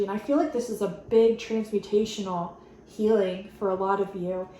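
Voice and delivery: usual speaking voice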